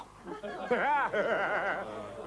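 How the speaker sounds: loud, high rolling laugh; hammed-up laugh. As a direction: roar at your own joke, high and loud. Laughs at own bad joke.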